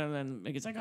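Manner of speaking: ordinary talk, nothing marked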